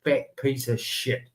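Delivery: low, slow and seething, the words spat out; emotional insult. Real, not acted. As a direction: hold the anger down and let it drag. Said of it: "Just getting a little emotional".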